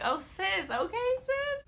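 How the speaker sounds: high, sassy "okay, sis"; sassy retort. Retold or imitated. Retelling the hallway bump, sassy high retort. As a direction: brush her off with a sassy lilt. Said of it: "I was like".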